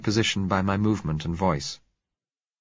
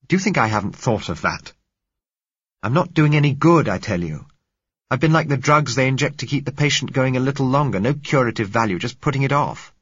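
louder and higher, frustrated protest; exasperated outburst; 6 semitones higher, 6 dB louder than usual. Acted. Man snaps at the girl who begs him to stay, exasperated. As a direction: push back hard, fed up with false hope.

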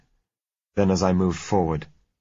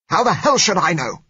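high, loud, bitter shout; bitter outburst; 13 semitones higher, 8 dB louder than usual. A narrator's reading. Exasperated blind man answers the patient, bitter shout. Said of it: "he said bitterly".

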